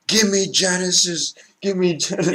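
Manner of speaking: high-pitched imitation of a yelled demand; mock yelling. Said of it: "he was just yelling that".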